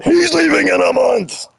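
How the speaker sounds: high, mock yelling the news. Streamer mocking chat for yelling, high mock yell. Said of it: "why he had to yell at".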